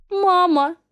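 high, drawn-out plaintive call; plaintive cry. Acted. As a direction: call out to mother in distress. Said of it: "жалобно протянула Злата".

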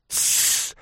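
sharp unvoiced shushing hiss. A narrator's reading. Ivan Andreevich shushing the young man in turn, hissing. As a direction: shush him hard to silence him. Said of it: "сделал в свою очередь Иван Андреевич".

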